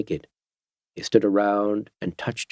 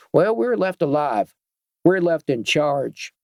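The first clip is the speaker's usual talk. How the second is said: sly biker captain; 5 semitones higher than usual. Acted. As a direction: play the smug biker leader explaining the new order. Voicing the gang captain.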